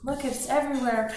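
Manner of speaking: playful fake alarm at a spill; mock upset. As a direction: ham up a flustered "oh no". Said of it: "I could get angry and upset".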